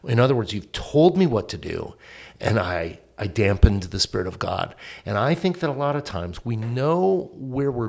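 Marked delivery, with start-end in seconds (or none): none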